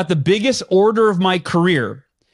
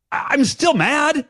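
slow, higher-pitched mock fuming; retold lingering anger; 7 semitones higher, slower than usual. Salesman whose five-minute timer just went off, mock mad. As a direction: draw it out, mildly peeved.

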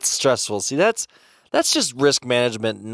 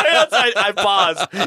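high laughing question; 11 semitones higher, 8 dB louder than usual. Real, not acted. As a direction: laugh through the words. Laughing at odd phrase.

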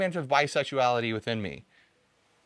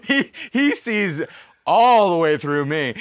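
laughing; 4 semitones higher, 9 dB louder, slower than usual. Real, not acted. Laughing at a friend's remark.

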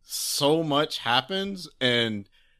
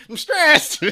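gangster voice; 7 dB louder than usual. Pretend. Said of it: "high tense situations".

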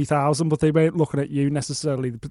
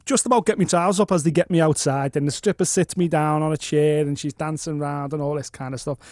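usual speaking voice

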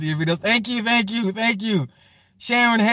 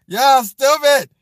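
louder, slower, stretched playful call-out; drawn-out loud tease; 11 semitones higher, 8 dB louder, slower than usual. Real, not acted. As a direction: drag it out teasing the chat. Laughing at chat, said twice.

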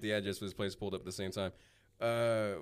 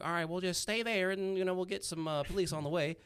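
higher-pitched phone operator voice; voiced desk woman; 9 semitones higher than usual. Case desk woman to victim awaiting police, high imitated voice. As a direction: imitate her, lifting your pitch well up.

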